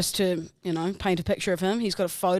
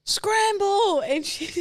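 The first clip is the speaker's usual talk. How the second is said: mock mum call; 10 semitones higher, slower than usual. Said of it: "she'd go".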